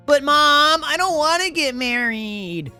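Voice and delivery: put-on high whining teen voice; whiny son. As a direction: protest like a sulky boy. Son protests to mother.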